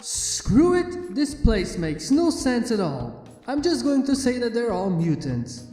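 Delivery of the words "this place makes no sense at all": plain reading voice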